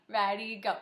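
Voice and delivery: ordinary talk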